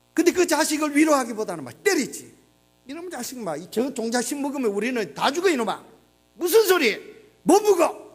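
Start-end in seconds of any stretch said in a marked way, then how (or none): 2.9-8.1 scolding that swells to a loud, high shout; a desperate father barking at his child; angry father. Retold or imitated. Father scolding hungry child over the seed grain, shouting. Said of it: "아버지가 막 화가 나지".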